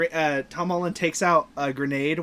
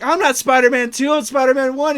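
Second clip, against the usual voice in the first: higher, louder insistence; raised, emphatic movie quote; acted-out correction; 8 semitones higher, 7 dB louder than usual. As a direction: correct him indignantly in character. Retelling film dialogue, correcting.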